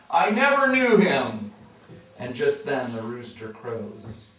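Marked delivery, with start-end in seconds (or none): 0.1-1.5 cursing denial. Retold or imitated. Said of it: "starts calling down curses".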